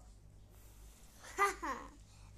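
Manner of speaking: breathy villain cackle; evil cackle. Acted. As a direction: laugh like a wicked wizard. Wizard laughs leaving.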